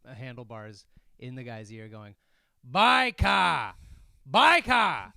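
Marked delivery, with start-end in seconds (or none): 2.7-5.1 put-on high, loud old-British-man shout; escalating British warning yell; louder British yell. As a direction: holler "bike-ah" right into someone's ear. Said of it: "So louder".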